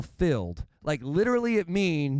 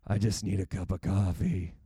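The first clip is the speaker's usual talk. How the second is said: worn-out morning self; 9 semitones lower than usual. Retold or imitated. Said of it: "Some days I'm like".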